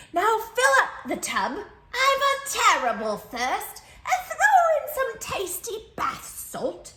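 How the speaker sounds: high, loud, fussy camel voice; demanding camel. Acted. Camel ordering the child around, high and fussy. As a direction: drawl out the demands like a spoiled camel.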